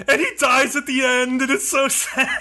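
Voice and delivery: high-pitched tearful gush breaking into laughter; mock blurting out. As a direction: imitate someone spilling a spoiler in distress. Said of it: "just blurts out".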